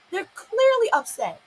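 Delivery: indignant insistence; emphatic repeat. Real, not acted.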